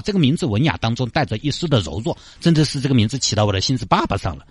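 usual speaking voice

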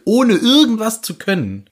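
exasperated at Harry; indignant outburst. Real, not acted. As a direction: voice jumps high and loud. Indignant emphasis.

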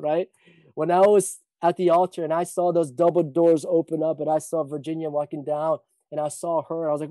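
normal talking voice

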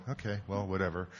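soft, dismissive retort; offhand aside. Retold or imitated. Preacher retelling his reply to the stubborn demon, soft offhand aside. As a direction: toss it off under your breath.

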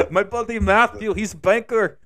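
high, loud fake Russian accent; Russian-accented Jesus. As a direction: boast in a mock Russian accent. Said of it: "why am I giving Jesus a Russian accent".

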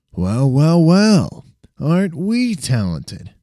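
high, slow mocking drawl; sarcastic. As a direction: sneer it with a knowing smirk. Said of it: "His tone sounded sarcastic again".

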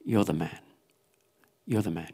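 low, soft, grave confrontation; quiet accusation. Retold or imitated. Preacher voices Nathan confronting King David, quiet accusation. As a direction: lay the charge quietly, pausing between repeats.

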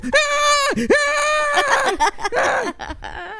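high panicked screams at the snake; screaming in terror. Retold or imitated. Screaming at the moving snake in terror. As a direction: shriek each time it moves. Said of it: "yo empiezo a gritar".

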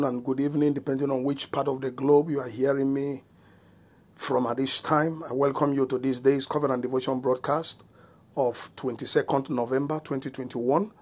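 ordinary talk, nothing marked

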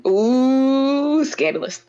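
high, drawn-out ooh of delight; titillated. Real, not acted. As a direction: savor the gossip. Reacting to appropriation claim.

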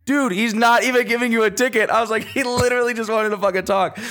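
exasperated. Retold or imitated. Teen in the passenger seat tells his mom the cop won't ticket her, exasperated. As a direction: spell it out to her, fed up.

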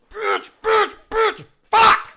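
very high, very loud shrieked swears; shrieking curses. Retold or imitated. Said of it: "gets really high pitched at the end".

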